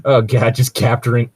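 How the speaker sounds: usual speaking voice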